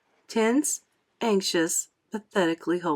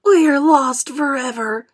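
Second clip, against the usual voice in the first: higher, louder hopeless cry; despairing; 7 semitones higher, 7 dB louder than usual. A narrator's reading. Ladybird crying out in despair. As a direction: give up aloud.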